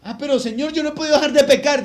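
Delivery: fast, high, loud protest to the Lord; the believer protests to God that he still sins, fast and pleading. Retold or imitated. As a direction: rush out the excuse, pleading. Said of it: "Y Él me mira y dice".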